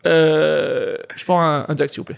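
lightly voiced drawling barfly ordering a drink; a hint of a cynical barfly asking for a Jack; Christopher Walken. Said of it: "Fais-nous Christopher Walken qui commande un Jack Daniels".

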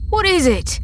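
high, sharp startled cry; alarmed question. Acted. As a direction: blurt it out in alarm. Men startled by the wub.

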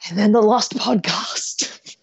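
slow whispered title. Acted. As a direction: whisper it like a secret.

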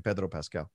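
"Pedro" with a rolled R, Spanish-style; rolled R's. Real, not acted. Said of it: "I love how you're rolling those R's".

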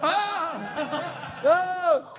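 very high, laughing yelp of alarm; startled shriek. Real, not acted. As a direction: recoil from the bug with a shriek. Bug comes near the car.

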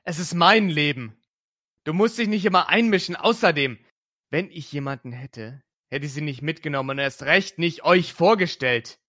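loud, fast furious outburst; angry bellow. Acted. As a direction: yell it back at the prying aunt. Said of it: "brüllte Julian".

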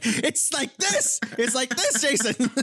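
imitating his wife drilling the word; mock wife correcting. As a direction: spell it out like a tired teacher. Wife correcting mispronunciation again.

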